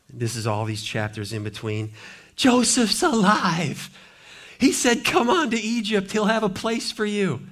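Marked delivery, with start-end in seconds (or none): 2.3-7.5 excited news. Acted. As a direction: blurt out the wonderful news. Sons telling Jacob the news.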